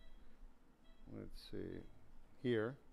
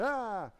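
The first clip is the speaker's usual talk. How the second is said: mimicked yell; 8 semitones higher, 3 dB louder than usual. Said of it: "he went".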